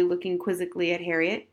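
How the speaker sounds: usual speaking voice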